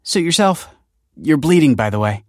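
high, loud brush-off; huffy parting shot; offended retort. A narrator's reading. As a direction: toss it back, stung. Girl retorts and walks off.

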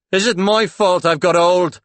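loud, high self-pitying outcry; wounded protest. Acted. Paul reproaching Claude over his age, loud wounded protest. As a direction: play the aggrieved ageing lover loudly.

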